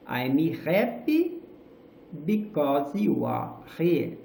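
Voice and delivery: each word stretched slowly with rising tune; slow, exaggerated articulation. Shown as a demonstration. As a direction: mouth every sound, very slowly. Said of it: "o som saia de uma... modo escutável e entendível".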